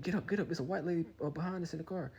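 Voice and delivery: high-pitched mock girlfriend voice; urgent girlfriend. Girlfriend to him with car behind, urgent imitation. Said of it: "She's like".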